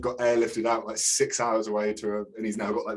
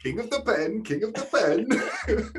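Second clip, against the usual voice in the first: mock Aussie; 10 semitones higher than usual. Mock Aussie hero line.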